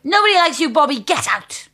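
hammed-up shout at Bobby; mock angry shout. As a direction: bark him out of the room, mock fury. Bad cop shouts at Bobby.

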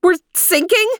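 high, startled question; alarmed cry. Acted. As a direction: panic rising in her voice. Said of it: "she cried".

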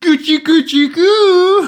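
put-on high cooing baby talk. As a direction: coo at him like a baby.

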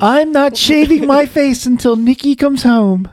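high, slow put-on voice; a goofy friend, drawn out high; Nick impression. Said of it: "Fake Nick".

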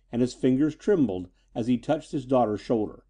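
ordinary delivery

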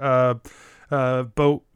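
ordinary talk, nothing marked

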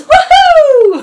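high Mario-style woohoo yell. Retold or imitated. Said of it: "he goes".

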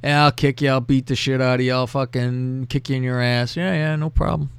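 low, offhand mock threats; nonchalant. Imitating the woman on the call threatening her client, nonchalant. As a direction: toss off threats like routine office chores.

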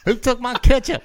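high, loud mock yell; yelling across the room. Mocking inmate yelling.

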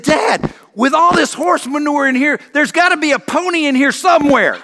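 bursting with joyful hope; delighted son. Retold or imitated.

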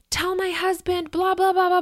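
high, loud, frantic ranting; irate ex-wife. Retold or imitated. Ex-wife yelling at her in the driveway, high and frantic. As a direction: imitate a furious woman yelling at a stranger. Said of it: "She's, like, losing her shit".

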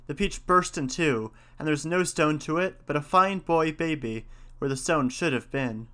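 ordinary delivery, nothing marked

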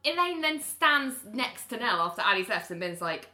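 ordinary talk

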